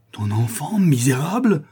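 outraged cry, loud and high on the last word; furious, horrified denunciation; terrible imprecation. A narrator's reading. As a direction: hurl it as a curse. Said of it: "cette imprécation terrible".

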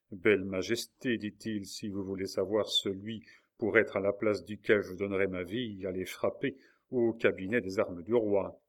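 usual speaking voice

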